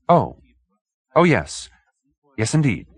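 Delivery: high, eager fluttering agreement; flustered little man. Acted. Hoag agreeing to the detective, high and flustered. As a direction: hurry to agree, a bit flustered.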